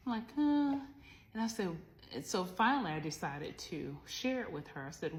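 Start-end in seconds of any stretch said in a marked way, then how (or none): 0.4-0.8 a sudden high, loud "uh" of being stuck; exasperated groan. Retold or imitated. Mother stuck for an answer to her daughter, loud high groan. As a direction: blurt out a loud, high-pitched stalling groan.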